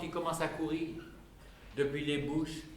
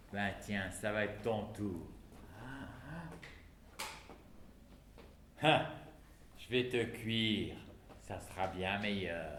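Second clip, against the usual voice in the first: low, soft and menacing; menacing threat; 6 semitones lower, 9 dB quieter than usual. Acted. Man threatening to cook the chick, low and soft. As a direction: purr the threat quietly.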